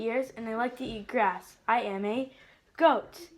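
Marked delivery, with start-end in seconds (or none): none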